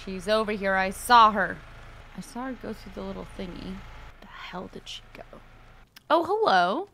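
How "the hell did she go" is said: breathy whispered question. Real, not acted. Killer hunts survivor, whispering. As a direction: hush it while hunting.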